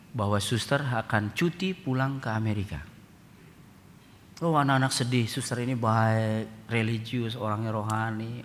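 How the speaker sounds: ordinary talk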